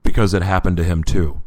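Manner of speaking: loud, low, firm answer; God's voice. Retold or imitated. God explains the father to the prisoner, low and loud. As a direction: state it with weighty authority.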